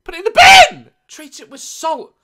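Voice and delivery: loud high outraged shout. Real, not acted. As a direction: yell it in disbelief. Outraged reaction to placenta.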